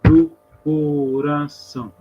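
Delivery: slow CO-RA-ÇÃO, loud at the start; syllable by syllable. Shown as a demonstration. Teacher scanning to the tenth syllable, slow scansion. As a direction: split CORAÇÃO into drawn-out syllables. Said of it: "a décima é forte".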